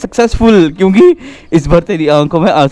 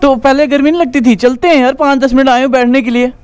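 higher, pretend-exasperated nagging; mock angry complaint; 6 semitones higher than usual. Brother complains to sister as part of an angry prank. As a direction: act annoyed with her. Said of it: "ये था हमारा angry prank".

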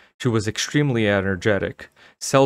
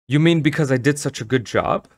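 higher-pitched offended question; demanding; 6 semitones higher than usual. A narrator's reading. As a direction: demand an answer, slightly stung. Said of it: "her mother demanded".

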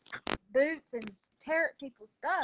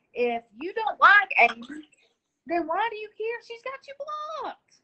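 angry outburst; 7 semitones higher, 9 dB louder than usual. Real, not acted. Heated on-air argument with viewer.

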